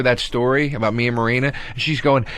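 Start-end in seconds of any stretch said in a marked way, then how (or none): none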